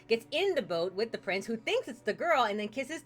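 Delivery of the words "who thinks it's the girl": usual speaking voice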